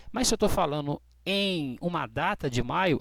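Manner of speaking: usual speaking voice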